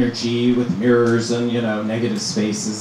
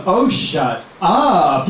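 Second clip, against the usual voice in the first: drawn-out, higher scoffing; mock disbelief; 5 semitones higher, much slower than usual. Friend scoffing at an introduction, slow mock disbelief. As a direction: stretch it out like you don't buy it.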